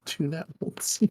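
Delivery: ordinary delivery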